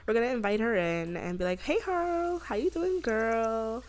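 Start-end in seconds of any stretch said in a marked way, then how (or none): 1.6-3.8 put-on high, drawn-out girlfriend hello; playful high-pitched hello; sing-song greeting. Acting out greeting a best friend.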